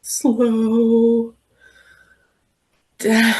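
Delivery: slow. Shown as a demonstration. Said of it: "I want you to say it really slow".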